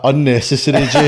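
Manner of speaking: laughing while quoting. Retold or imitated.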